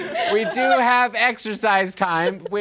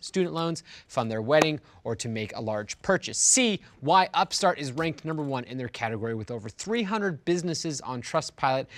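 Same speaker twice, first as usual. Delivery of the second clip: smooth sponsor read; 4 semitones lower, 5 dB quieter than usual. Acted. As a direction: read it like a radio ad. Host reads sponsor ad.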